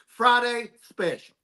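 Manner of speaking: high, drawling wrestling promo voice; Dusty Rhodes impression. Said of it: "like the American Dream, Dust the Roads".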